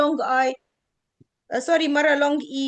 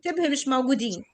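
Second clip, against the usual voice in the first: usual speaking voice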